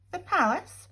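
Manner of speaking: plain reading voice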